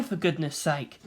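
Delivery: usual speaking voice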